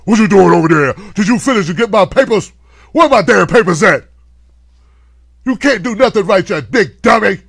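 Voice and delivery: loud, harsh berating boss; angry boss. Acted. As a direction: bark at the useless assistant.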